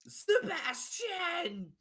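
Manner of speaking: gasp, then a long high shout; hammed-up reunion shriek; overjoyed father. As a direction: cry out the name, loud and drawn out. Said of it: "the father goes".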